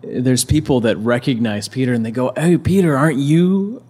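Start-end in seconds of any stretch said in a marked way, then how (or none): 2.3-3.8 higher, louder mock call to someone recognised; raised, rising challenge, acting out a bystander; questioning recognition. Bystanders spotting the disciple by the fire, questioning recognition.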